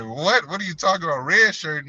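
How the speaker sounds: high-pitched, incredulous questioning; retold disbelief. Player reacting to coach's redshirt call, incredulous. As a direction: re-enact being stunned by the news.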